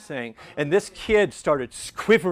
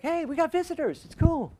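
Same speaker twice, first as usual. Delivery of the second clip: casual greeting. Retold or imitated. Greeting visitors from the organ.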